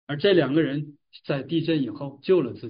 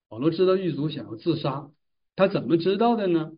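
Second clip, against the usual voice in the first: ordinary delivery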